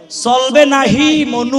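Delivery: slow, lilting Barishal Bengali; Barishal dialect. Shown as a demonstration. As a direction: drawl it like a Barishal local. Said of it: "Barishal ভাষা কি বলে".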